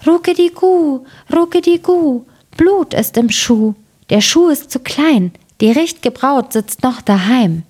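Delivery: high, cooing dove call; rufende Täubchen. A narrator's reading. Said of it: "saßen die zwei Täubchen darauf und riefen". (hoher, gurrender Taubenruf)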